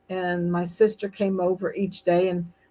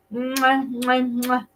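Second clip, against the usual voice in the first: three smacking air kisses, higher; blown kisses; 5 semitones higher, slower than usual. Real, not acted. Host blowing kisses to a friend across the pond, smacking air kisses. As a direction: blow kisses down the camera.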